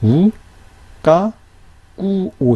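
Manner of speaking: 우, 까, 꾸, 오 with pauses between syllables. Shown as a demonstration. Teacher breaking down syllables, 우, 까, 꾸, 오 said one by one. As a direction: separate 우, 까, 꾸, 오, kk starting the second syllable. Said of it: "다음 모음의 초성처럼 사용이 되겠죠".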